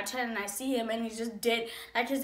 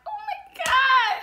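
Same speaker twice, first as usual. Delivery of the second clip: high, loud mock gush; 14 semitones higher, 7 dB louder than usual. Bonding with a friend not seen lately, gushing. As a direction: squeal it like a delighted greeting.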